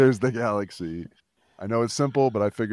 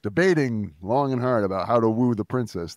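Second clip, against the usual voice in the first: ordinary delivery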